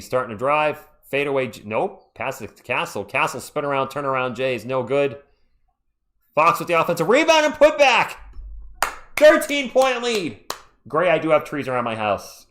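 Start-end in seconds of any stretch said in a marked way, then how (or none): 6.4-10.5 excited shout. Real, not acted. Live call of a rebound.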